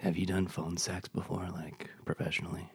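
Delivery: soft, whispered prying question; hushed phone-sex tease. Acted. Teasing guest about phone sex.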